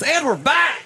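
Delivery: big hammed-up on-air yell; radio voice guy. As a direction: announce the return like a zany local DJ. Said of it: "like the guy that does eight voices".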